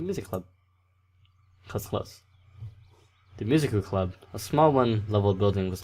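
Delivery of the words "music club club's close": whisper. Real, not acted. Muttered aside.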